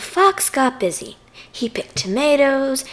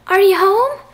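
high, loud calling question; yelled question; 8 semitones higher, 7 dB louder than usual. A narrator's reading. Visitor calls through the fox's door, yelling. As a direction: holler it up high, to someone indoors. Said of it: "someone yelled outside".

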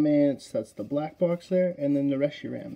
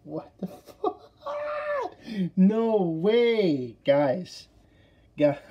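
shocked outburst; 5 semitones higher, 4 dB louder, much slower than usual. Real, not acted. Rare card pulled, shock.

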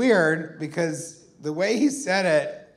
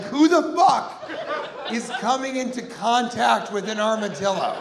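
outraged shout; 9 semitones higher than usual. Retold or imitated. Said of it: "I'm like".

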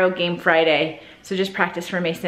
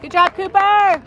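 yelling from the sidelines; 10 semitones higher, 4 dB louder than usual. Real, not acted. Cheering player from stands.

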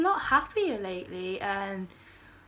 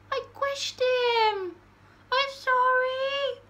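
high, slow hammed-up wail; mock wailing; 12 semitones higher, 4 dB louder, much slower than usual. Apologising to the squished bug, a slow high mock wail. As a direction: wail an over-the-top apology.

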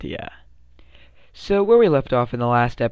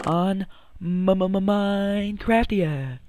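slow, higher announcer stutter; stuttered hype; 5 semitones higher, much slower than usual. Pretend. Announcing tomorrow's live stream, stuttered hype. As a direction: hype it like a DJ echo.

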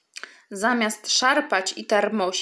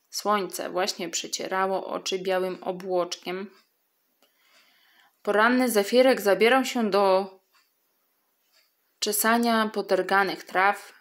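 ordinary delivery

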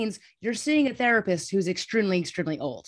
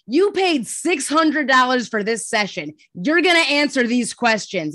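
loud, higher, emphatic insistence; blunt psychiatrist; 5 semitones higher, 8 dB louder than usual. Retold or imitated. Psychiatrist to a patient refusing to answer, blunt. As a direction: lay down the law, hitting each phrase hard. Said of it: "never had a more blunt discussion".

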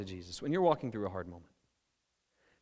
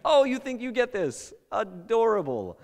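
put-on higher, patronizing "adorable" to a child; condescending mock; 9 semitones higher, 5 dB louder, slower than usual. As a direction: tease them like a parent amused by a kid.

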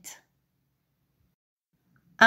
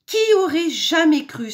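high, loud, mildly sighing amazement; sighed wonder; 8 semitones higher, 6 dB louder than usual. A narrator's reading. Wolf delighted by stone soup, sighing wonder. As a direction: marvel with a hint of a sigh. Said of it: "soupira-t-il".

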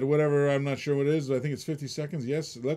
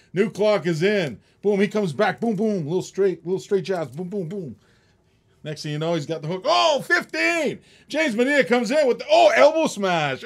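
hyped ringside commentary, higher and louder; excited play-by-play; 5 semitones higher, 9 dB louder than usual. Acted. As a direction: call the bidding like a boxing match. Mock boxing play-by-play of the bidding.

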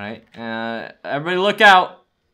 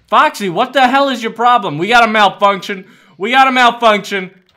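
loud, higher-pitched scolding alarm; alarmed shout; 5 semitones higher, 8 dB louder than usual. Real, not acted. Player to Foxy as it malfunctions, alarmed shout. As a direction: call out the malfunction urgently. Robot misbehaves mid-game.

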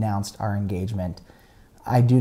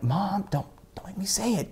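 imitated teen's pleading whisper; urgent whisper; 8 semitones higher, 5 dB quieter than usual. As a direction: whisper so the little siblings won't hear. Teen hushing mom near siblings.

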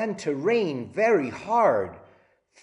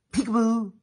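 high, louder playful call; playful peekaboo; 9 semitones higher, 6 dB louder, faster than usual. Shown as a demonstration. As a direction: surprise the kids with it. Peekaboo game with children.

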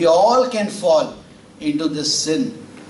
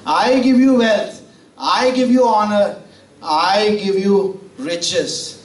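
repeating God's promise; 5 semitones higher than usual. Acted. Repeating God's words, slowing.

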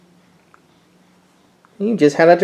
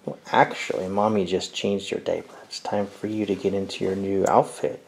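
much lower and softer; soft murmur; 9 semitones lower, 11 dB quieter than usual. Real, not acted. Mother murmuring to baby.

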